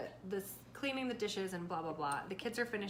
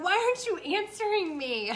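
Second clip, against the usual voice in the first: much higher, louder exasperated call; exasperated yell; 10 semitones higher, 9 dB louder than usual. Retold or imitated. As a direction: demand an answer, fed up. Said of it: "I'm like".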